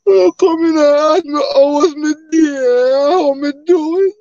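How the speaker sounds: high, hammed-up fake sobbing in a Jamaican lilt; mock crying. Streamer pretends viewers hurt his feelings, mock crying. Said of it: "I'm just gonna come off my camera and cry".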